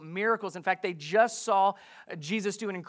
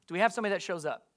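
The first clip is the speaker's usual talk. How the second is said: quick repeat; much faster than usual. Real, not acted. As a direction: toss the question out rapidly for an answer. Same question repeated quickly for response.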